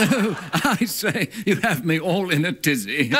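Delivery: high fluttery posh Englishwoman; flustered posh British lady; British accent. Pretend. Answering the Downton bit, high posh English accent. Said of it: "British accent".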